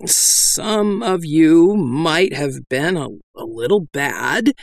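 slow, hesitant admission; reluctant. A narrator's reading. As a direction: drag the words out unwillingly. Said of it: "Doll continued reluctantly".

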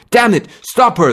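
loud, high alarmed shout. Acted. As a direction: shout a sharp warning. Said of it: "the doctor shouted".

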